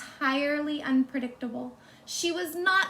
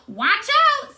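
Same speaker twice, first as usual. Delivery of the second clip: much higher and louder alarmed cry; sharp warning; 11 semitones higher, 11 dB louder than usual. Acted. Eugenia warning everyone about the piglet, loud alarmed cry. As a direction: yell a warning as the pig charges.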